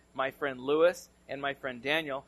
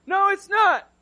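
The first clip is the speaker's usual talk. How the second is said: mock trapped yell; 13 dB louder, slower than usual. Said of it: "We're like".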